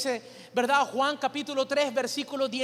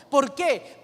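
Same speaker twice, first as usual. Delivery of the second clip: ordinary delivery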